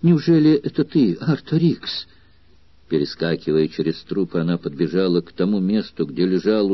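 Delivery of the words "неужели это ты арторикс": recognise him with a burst of joy; joyful cry. A narrator's reading. Girl recognises her dying lover, high joyful cry. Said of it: "радостно вскрикнула девушка".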